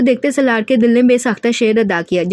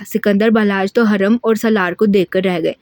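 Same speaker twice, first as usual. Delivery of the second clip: ordinary delivery, nothing marked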